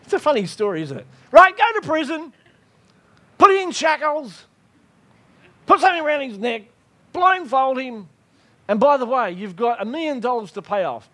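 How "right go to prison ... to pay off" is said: loud, high commands to jailers; high-pitched, forceful sentencing of a prisoner; barked orders. Acted. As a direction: rap out orders like an angry master.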